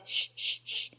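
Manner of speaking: soft voiceless hisses; short, quiet snake hisses. Shown as a demonstration. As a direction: hiss like a snake for a toddler. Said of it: "what sound does a snake make".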